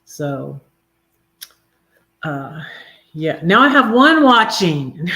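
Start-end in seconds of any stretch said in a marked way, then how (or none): 3.1-5.2 higher and louder, amused; amused surprise. Real, not acted. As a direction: react to the dropping viewer count. Notices viewer count drop.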